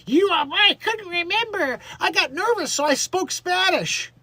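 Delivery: high, far-up mocking impersonation of a flustered woman; mocking a celebrity's nervous excuse, pitched high; mock Hilaria.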